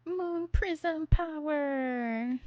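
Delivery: high, slow, drawn-out transformation cry; magical girl transformation. Pretend. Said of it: "like, a magical girl transformation".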